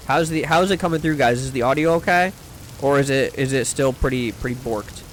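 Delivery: plain reading voice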